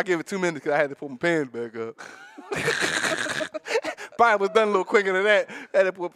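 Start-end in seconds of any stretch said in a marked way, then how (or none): 2.0-4.1 high breathless wheeze of a laugh; wheezing laugh. Real, not acted. Cracking up at his own quick finish, wheezing laugh.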